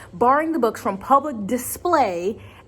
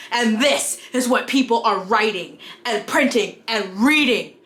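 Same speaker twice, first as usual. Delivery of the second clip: outraged prudish character, hammed up; hammy stage actor. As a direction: overplay a scandalized conservative. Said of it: "thank you if you watched through my terrible skit".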